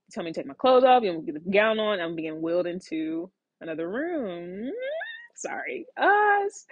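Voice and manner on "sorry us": real welling emotion. Real, not acted. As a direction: voice breaking, near tears. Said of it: "I'm reliving it".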